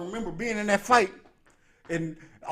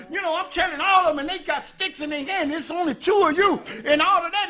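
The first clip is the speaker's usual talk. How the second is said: raised, rowdy retelling of his own fight taunts; mock fight taunt; 8 semitones higher than usual. Preacher reliving his street fight taunts, half-shouting. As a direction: hype it up like squaring off.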